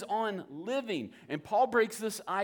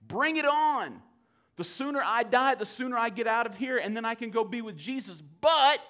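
loud, higher, bold Paul daring death; eager Paul; 4 semitones higher, 6 dB louder than usual. Retold or imitated. Paul to the church, fearless loud bravado. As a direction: shout it out like you can't wait. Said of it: "Paul is just like".

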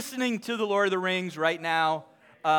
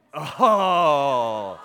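mock awe; 6 semitones lower, 5 dB louder than usual. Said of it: "you're like".

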